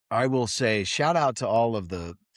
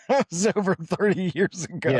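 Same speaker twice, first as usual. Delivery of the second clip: high-pitched, laughing through it; words riding up on a laugh; laughing disbelief; 7 semitones higher than usual. Real, not acted. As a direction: laugh as you say it. Laughing at how long ago.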